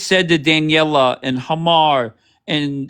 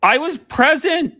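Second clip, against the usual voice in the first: high, mocking imitation of the host; mock whiny quote; 12 semitones higher than usual. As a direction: parrot her words in a high sneering voice. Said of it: "you say".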